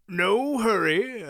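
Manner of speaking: slow, wheezy and cracking; wheezy old voice. A narrator's reading. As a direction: wheeze it out like a doddering old man. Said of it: "wheezy, cracking voice".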